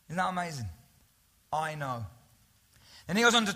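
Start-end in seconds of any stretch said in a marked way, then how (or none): 1.5-2.1 soft, low and gentle; tender reassurance. Acted. Jesus assuring the suffering church at Smyrna, soft and tender. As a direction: let it land softly, like a quiet comfort. Repeating Jesus' words softly.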